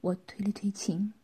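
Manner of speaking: usual speaking voice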